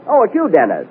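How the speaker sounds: ordinary talk, nothing marked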